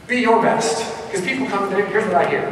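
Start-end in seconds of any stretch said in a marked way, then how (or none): 0.1-0.8 emphatic. Shown as a demonstration. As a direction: punch out Be your best. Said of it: "just be your best".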